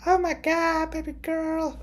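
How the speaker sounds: high, loud gushing squeal; squealing at good news; excited squeal. Real, not acted. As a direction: gush like you can't contain it. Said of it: "Okay, sorry".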